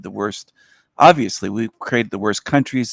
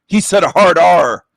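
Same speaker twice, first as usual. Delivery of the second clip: loud, higher put-on voice; mock outraged shout, higher and louder; 6 semitones higher, 9 dB louder than usual. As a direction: bellow it like an outraged caller.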